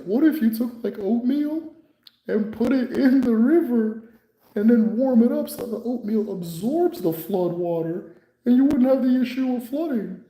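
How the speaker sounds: high, dreamy invention pitch; imitating the patient proposing oatmeal in the river. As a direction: hold the voice high and earnest.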